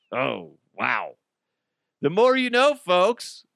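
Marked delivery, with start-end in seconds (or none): 2.0-3.4 higher, louder jingle-like tagline; sing-song public service slogan; mock TV slogan; mock PSA announcer.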